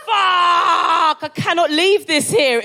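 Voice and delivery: high, drawn-out cry of alarm; panicked outburst. Retold or imitated. Woman seeing her frozen sick on the windowsill, panicked outburst. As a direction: wail the curse in hammed-up panic.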